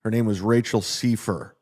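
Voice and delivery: normal talking voice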